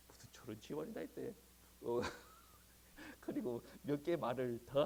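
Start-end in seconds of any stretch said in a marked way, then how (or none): 0.1-1.0 inner thought. Retold or imitated. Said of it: "뭐라고 했냐면 속으로".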